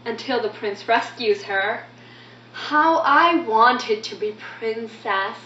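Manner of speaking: excited, quick storytelling in a lilting voice; lively, theatrical poem recital; animated child narrator. Acted. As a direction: recount a favourite game with childlike eagerness.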